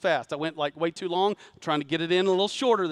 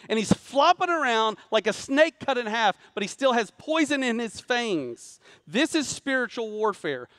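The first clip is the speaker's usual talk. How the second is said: fiery preaching; 5 semitones higher than usual. Acted. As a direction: preach it with rising fire. Preaching rises to full force.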